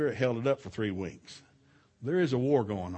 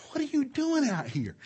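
retold puzzled questioning; 14 semitones higher than usual.

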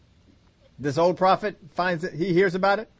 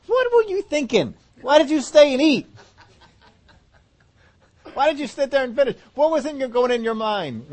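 incredulous questioning; 7 semitones higher, 3 dB louder than usual. Acted. Asking prophet in heaven.

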